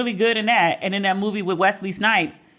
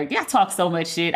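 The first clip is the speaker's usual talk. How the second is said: ordinary talk, nothing marked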